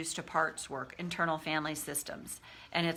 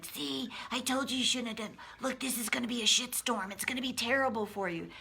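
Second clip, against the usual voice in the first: higher-pitched, put-on scolding bully; inner bully voice; 5 semitones higher, faster than usual. Coach voicing the bully after you stood up, scolding bully voice. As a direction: scold as the nagging bully in the head. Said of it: "And then this voice comes in".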